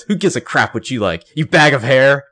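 loud, sneering put-down; mock insult. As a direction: hurl the insult like Leslie. Leslie's insult, retold.

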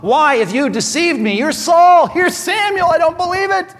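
high, loud panicked cry; the medium yells in disbelief at the king; shocked outcry. Retold or imitated. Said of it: "She says in verse twelve".

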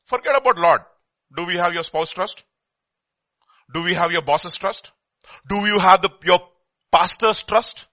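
rising, loud, pointed questions to the church; fired-up preacher calling out; preaching. Acted. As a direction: press each question on the congregation. Preacher presses the congregation.

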